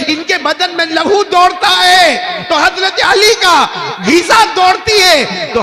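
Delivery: very high, loud shouted proclamation; full-force preaching. Real, not acted. Preacher proclaiming who Hussain is, full-force preaching. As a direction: cry out the praises to the whole gathering.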